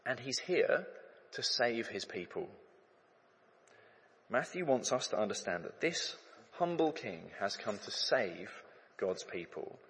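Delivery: ordinary talk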